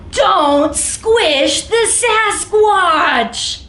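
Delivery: slow, pleading storybook refrain; drawn-out refrain. Acted. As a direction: plead it slowly and sweetly.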